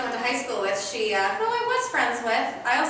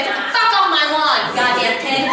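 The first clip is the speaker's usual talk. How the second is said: put-on louder cranky old lady yell; old woman yelling; 8 dB louder than usual. Seventy-year-old woman yelling at a kid on her lawn, loud. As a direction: bark at the kid from the lawn chair. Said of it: "yell at him and say".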